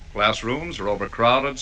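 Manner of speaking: ordinary talk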